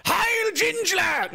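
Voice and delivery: drawn-out, very high-pitched mock cry; hammed-up high salute call. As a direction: stretch it out in a high, silly yelp. Mock salute cry amid laughter.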